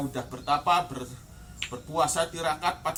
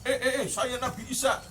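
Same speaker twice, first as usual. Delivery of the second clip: careless, higher-pitched blurting; sembarangan; 6 semitones higher than usual. Shown as a demonstration. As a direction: blurt it casually with no dignity at all. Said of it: "nggak begitu kan". (asal ceplos, nada tinggi tanpa wibawa)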